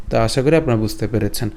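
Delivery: usual speaking voice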